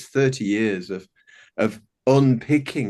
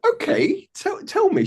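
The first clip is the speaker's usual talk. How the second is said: gentle dad. Retold or imitated. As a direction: coax a crying child to talk. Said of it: "And I'm like".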